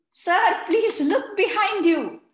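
put-on urgent kid warning; squealing kids. Kids warn teacher about frog, high and excited.

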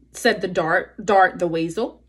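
plain reading voice

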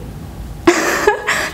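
high, airless stifled laugh; a burst of breathless giggling; breathy giggle. Real, not acted. Breaks into laughter on call.

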